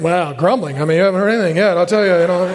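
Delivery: low, muttered griping; put-on grumbler; mock grumbling. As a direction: ramble grumpily, petering into nonsense. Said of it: "I'm trying to work on the grumbling thing".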